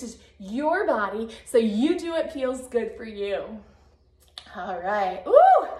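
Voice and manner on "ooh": ooh of surprise. Real, not acted. Reacts to the heat.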